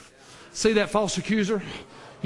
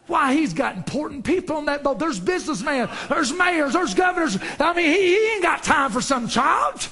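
raised, high-pitched scolding; dismissive old man; 8 semitones higher than usual. Acted. Old man telling the boy the boat has no time, indignant. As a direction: pile up the list in disbelief.

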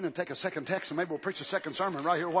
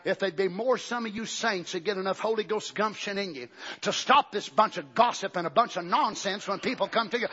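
ordinary talk, nothing marked

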